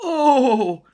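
high, loud blissful moan; happy groan. A narrator's reading. Storybook groaning with joy at being kidnapped. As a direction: moan with pleasure, squeaky and loud. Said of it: "nyögött fel boldogan".

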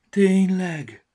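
high, loud little voice of hope; a tiny glasses' hopeful cry; high-pitched small creature asking; sighing glasses. Acted. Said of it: "sóhajtott fel Vaksi".

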